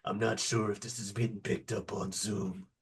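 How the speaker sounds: low, very soft godfather murmur; imitated whispering. Whispering godfather warning his men to behave, low and hushed. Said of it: "he's whispering and just kinda".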